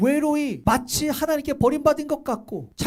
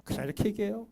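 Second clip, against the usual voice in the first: ordinary talk, nothing marked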